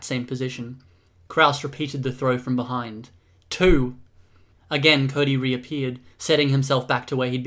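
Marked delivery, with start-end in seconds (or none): 3.5-4.0 loud single-word count; loud, punchy count; called-out count. Acted. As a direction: call the number out forcefully. Counting out repeated throws.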